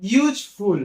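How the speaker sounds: "ইউজফুল" split in two beats, ইউজ then ফুল; two-part pronunciation. Shown as a demonstration. Teacher showing a word pronounced in two parts, syllable by syllable. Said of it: "যেগুলো দুবার উচ্চারণ করছি".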